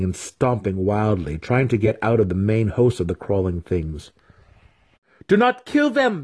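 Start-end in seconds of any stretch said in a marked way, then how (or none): 5.3-6.2 very high-pitched alarmed cry; panicked scream. A narrator's reading. Student stopping his mentor stomping spiders, screaming. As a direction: scream the warning in a piping voice. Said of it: "Massage screamed".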